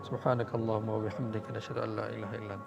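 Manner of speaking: soft, fading closing supplication, speeding up; closing prayer. Real, not acted. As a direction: murmur the closing dua, trailing away. (doa penutup yang perlahan)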